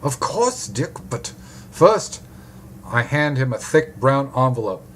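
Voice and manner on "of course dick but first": put-on German-accented doctor, pitched up; broad German accent. A narrator's reading. Doctor stalls the detective for payment, German accent. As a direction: answer higher, as a German doctor. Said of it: "he says in a broad German accent".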